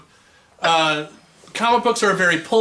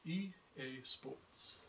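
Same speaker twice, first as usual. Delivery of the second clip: hushed slogan imitation; 26 dB quieter than usual. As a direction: murmur it like a game's startup slogan. Imitating a video game slogan.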